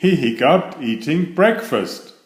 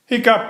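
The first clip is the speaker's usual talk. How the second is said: hiccup; 11 semitones higher, 3 dB louder than usual. Acted. Franklin's hiccups.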